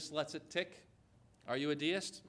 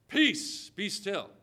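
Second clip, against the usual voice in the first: commanding rebuke; 2 semitones higher, 4 dB louder, much slower than usual. Acted. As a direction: rebuke a storm with one sudden loud command. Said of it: "rebuked the wind and the sea".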